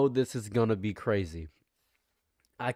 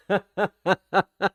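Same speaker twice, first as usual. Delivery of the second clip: hearty higher belly laugh; rich uncle laugh; 7 semitones higher, 5 dB louder than usual. Shown as a demonstration. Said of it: "Is that a rich uncle laugh?".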